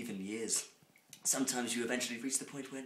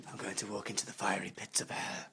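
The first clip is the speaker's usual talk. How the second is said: hushed, lower ominous whisper; spooky whisper; 4 semitones lower than usual. Acted. Reader teasing the audience in a creepy whisper. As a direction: whisper it low like a dark omen.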